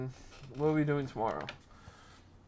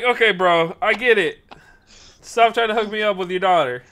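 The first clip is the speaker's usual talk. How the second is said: loud, higher, exasperated; fed-up protest; 7 semitones higher, 8 dB louder than usual. Retold or imitated. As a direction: tell him off in mock exasperation, voice raised. Fed up with dad's matchmaking.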